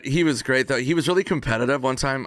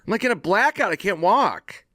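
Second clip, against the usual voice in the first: put-on drunk complaint; 9 semitones higher than usual. Drunk friend complaining to Norm.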